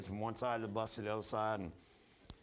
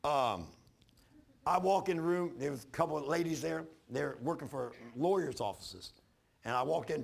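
usual speaking voice

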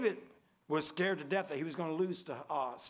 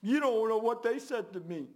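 aggrieved complainer; 6 semitones higher, 5 dB louder than usual. Retold or imitated. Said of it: "rather than saying".

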